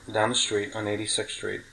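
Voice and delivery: ordinary delivery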